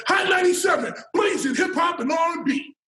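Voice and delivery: high, shouted hype-man radio read; radio announcer voice. Retold or imitated. A rookie reading the station promo, booming announcer voice. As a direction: belt it like a hip hop station promo. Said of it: "I don't even know I got this voice".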